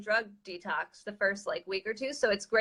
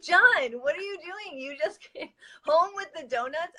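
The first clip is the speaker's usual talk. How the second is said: higher, half-laughing mock outrage at her husband; retold angry outburst; 6 semitones higher than usual. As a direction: replay the indignant yell, lightly. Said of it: "I was, like, so angry".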